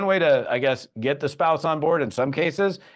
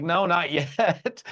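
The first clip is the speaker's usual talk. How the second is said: laughing no; 6 semitones higher, much slower than usual. Retold or imitated. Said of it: "I'm like".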